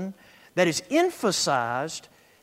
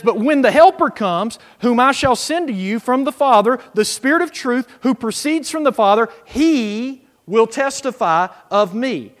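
preached Scripture reading; 4 semitones higher, 8 dB louder than usual. Acted. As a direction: proclaim the verse to the congregation. Reads Scripture aloud.

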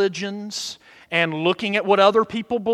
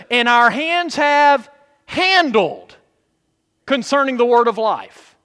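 scripture reading; 3 semitones higher, 4 dB louder than usual. Acted. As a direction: read the verse with weight. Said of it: "notice what he says in verse one".

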